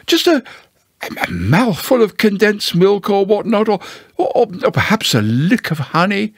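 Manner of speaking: high, halting hopeful bear; hint hesitantly for a treat; Pooh, wistful. Acted. Pooh angles for honey in Owl's parlour, wistfully.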